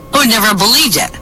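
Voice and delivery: amazed disbelief. Acted. Bartender amazed by hand phone.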